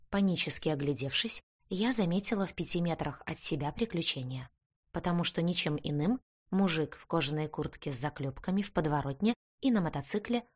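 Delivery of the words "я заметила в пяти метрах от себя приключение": usual speaking voice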